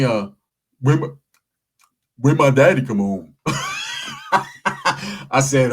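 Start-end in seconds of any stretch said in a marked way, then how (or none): 0.8-3.4 put-on kid asking. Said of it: "he tells me". 3.4-5.2 squealing high laugh. Real, not acted. Narrator laughing hard at the kid's question, very high.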